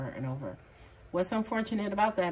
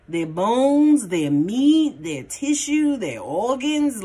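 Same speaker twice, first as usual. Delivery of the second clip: louder, punching each item in the list; emphatic; 5 semitones higher, 9 dB louder, much slower than usual. Real, not acted. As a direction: pile it on, building excitement. Emphatic list of bodily desire.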